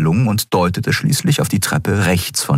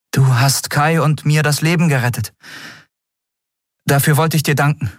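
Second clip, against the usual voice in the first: higher voice for the captain Endriel, curious; Endriel, raised voice; 10 semitones higher than usual. Acted. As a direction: lift the pitch, eager question. Endriel thanks Kero. (höhere Stimme, neugierig fragend)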